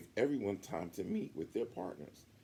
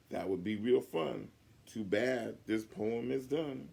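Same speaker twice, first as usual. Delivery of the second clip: louder, proud sing-song reading; a kid shouting his poem to the class; loud recital; 4 semitones higher, 6 dB louder than usual. Acted. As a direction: belt out a silly kid poem. Goob reciting loudly.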